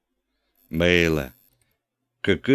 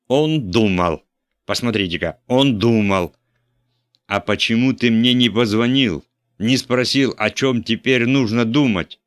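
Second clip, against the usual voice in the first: loud, higher furious bishop; furious scolding; 5 semitones higher, 5 dB louder than usual. A narrator's reading. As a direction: tear into the priest, yelling. (епископ в ярости кричит на патера)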